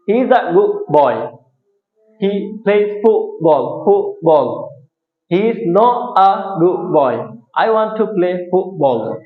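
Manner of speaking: slow demo with the t and d swallowed in "good", "football", "not"; American glottal stop demo. Shown as a demonstration. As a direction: hold the t and d in the throat, very slowly. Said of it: "এবার দেখো আমি একটু উচ্চারণ করি". (শিক্ষকের ধীর উচ্চারণ, "good" ও "football"-এর ট-ড গলায় আটকে)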